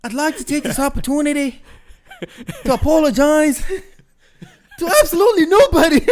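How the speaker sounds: high, loud Conor McGregor impression; Irish accent. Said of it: "Oh, I love that quote".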